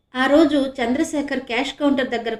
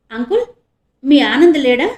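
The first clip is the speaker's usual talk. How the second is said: innocently; 2 semitones higher, 9 dB louder than usual. A narrator's reading. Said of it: "అమాయకంగా అడిగింది".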